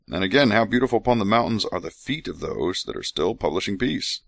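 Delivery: measured scripture recital; scripture reading. Acted. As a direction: read it like holy writ. Reads scripture aloud.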